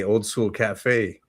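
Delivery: ordinary delivery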